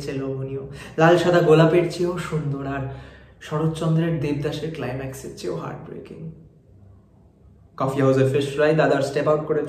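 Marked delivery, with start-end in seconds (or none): none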